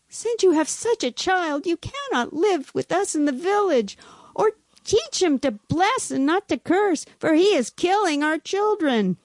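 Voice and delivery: high-pitched, aggrieved ultimatum; blaming. A narrator's reading. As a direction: plead and accuse as wronged parents. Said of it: "blamed him".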